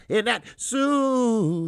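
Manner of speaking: very drawn-out, loud, high whoop; hyped holler. Real, not acted. Host greeting another viewer in the chat, hooting loudly. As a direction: hoot the last word out long.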